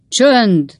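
loud, high bark for silence; thundered command. A narrator's reading. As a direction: roar it to hush the crowd. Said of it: "dörögték a polgármester emberei".

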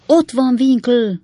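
high, loud, drawn-out storybook call. A narrator's reading. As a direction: point someone out urgently, slowly and loudly. Character points out a friend in crowd. (hangos, elnyújtott odakiáltás)

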